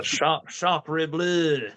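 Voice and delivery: mock French with a long Southern drawl. Joking about local French speakers, Southern drawl French. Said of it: "like a Southern drawl French".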